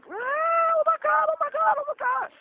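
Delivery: high, slow panicked shriek; mimicking the horrified supervisor; imitating a scream. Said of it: "She starts, starts screaming".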